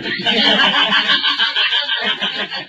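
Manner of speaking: airy spooky shout; breathy eerie howl in the dark; ghostly shriek. Retold or imitated. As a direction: wail like a haunted-house ghost.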